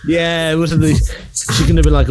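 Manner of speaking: usual speaking voice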